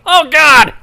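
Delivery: high, very loud exclamation; Brad's outburst. Retold or imitated. Host imitates a crewmate overheard during the show, loud high cry. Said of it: "you can hear in the quietness Brad go".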